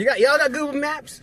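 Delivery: high, twangy Southern voice; mock southern accent. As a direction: play a Jersey local as a Southerner. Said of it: "everyone in South Jersey has a southern, southern accent".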